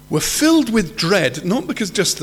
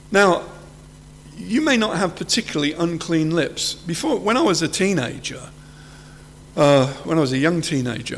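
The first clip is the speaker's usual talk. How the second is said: ordinary talk, nothing marked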